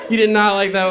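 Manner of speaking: shouted through laughter; loud excited punchline. Real, not acted. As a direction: belt it out laughing.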